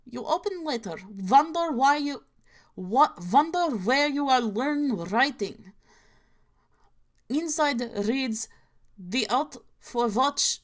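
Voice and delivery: hammed-up inaccurate Russian accent; a deliberately bad Russian accent, played for laughs. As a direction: read it like a comic Soviet narrator with a bad Russian accent. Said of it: "doing this in such a bad, uh, Russian accent".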